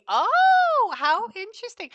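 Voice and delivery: high, loud drawn-out oh of discovery; real surprise. Real, not acted. Medium learns the spirit is Hungarian, real surprise. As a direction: burst out in astonished realisation. Sudden realisation about his nationality.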